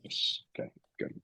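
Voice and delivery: whispered under the breath; a private whispered aside, off-mic. Real, not acted. Muttered aside off the call.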